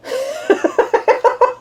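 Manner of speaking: high giggling laugh; helpless high-pitched giggle. Real, not acted. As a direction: burst into a long, high laugh. Amused by the previous remark.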